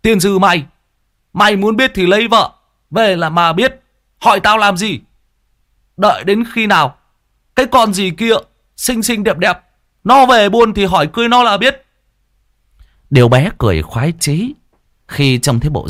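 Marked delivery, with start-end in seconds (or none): 0.0-8.3 high, flustered mock cursing; uncle's embarrassed scolding. 8.8-9.6 high, lilting "xinh xinh đẹp đẹp"; uncle's teasing voice. Acted. 10.0-11.9 high, flustered mock cursing; uncle's embarrassed scolding.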